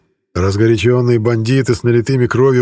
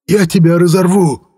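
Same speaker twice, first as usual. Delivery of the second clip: much higher, louder threat; threatening shout; 8 semitones higher, 4 dB louder than usual. A narrator's reading. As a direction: scream it to scare him. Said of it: "выкрикнул Лис".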